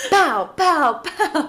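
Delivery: high, loud cartoon pow sounds; mock punching noises. Host voicing the little brother hitting his sister, cartoon pow noises. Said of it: "he's just like".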